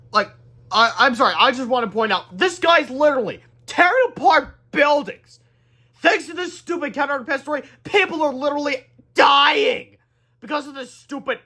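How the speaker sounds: high, loud incredulous rant; exasperated rant. Real, not acted. Reader ranting about monster destruction, loud exasperated rant. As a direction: vent at the absurd story. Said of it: "reader rants at story".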